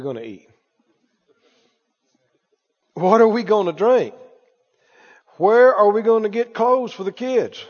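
mimicking a fretful doubter; lightly voiced anxious worrier; worried unbeliever. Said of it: "That's how unbelief talks".

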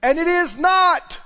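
slow, loud emphatic declaration; preaching hard. Acted. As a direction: hammer it home from the pulpit. Preaching at full force.

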